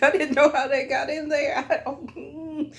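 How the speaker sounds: high-pitched, talking through laughter; giggly, helpless denial; laughing excuse. Real, not acted. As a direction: protest innocence while cracking up.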